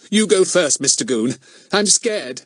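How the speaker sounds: high, loud fake fright; mock scared. A narrator's reading. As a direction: pretend to be frightened. Fatty fakes fear.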